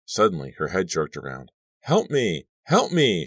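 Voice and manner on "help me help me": high, frightened cry for help. A narrator's reading. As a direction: cry out in rising panic. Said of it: "she cried".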